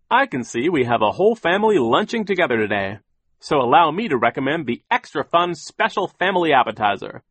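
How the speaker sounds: high, loud, sing-song server's pitch; overeager waiter. A narrator's reading. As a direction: sell the menu with bouncy, swooping cheer. Waiter recites his welcome.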